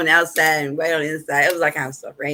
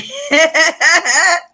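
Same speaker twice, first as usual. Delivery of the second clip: laugh; 10 semitones higher, 5 dB louder than usual. Real, not acted. Laughs after story beat.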